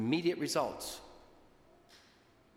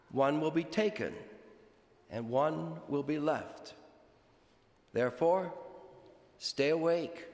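solemn, measured liturgical reading; gospel reading; 3 semitones higher than usual. Acted. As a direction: proclaim it from the pulpit. Priest proclaims the gospel.

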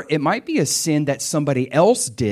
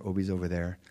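ordinary talk, nothing marked

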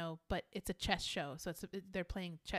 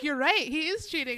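loud, higher emphatic agreement; 5 semitones higher, 10 dB louder than usual. Retold or imitated. Friend agreeing the player cheats, loud emphatic. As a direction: back up your friend with a loud yes. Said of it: "'Cause Lauren was like".

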